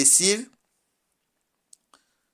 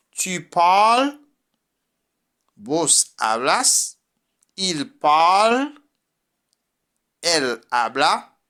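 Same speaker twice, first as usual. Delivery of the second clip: ordinary talk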